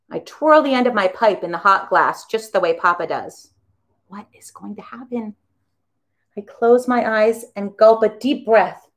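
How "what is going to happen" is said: whisper. A narrator's reading. Nervous inner thought.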